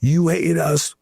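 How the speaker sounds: high-pitched, hammed-up retold outburst; mock angry yell. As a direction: imitate a furious voter shouting an accusation. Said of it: "screaming expletives at us".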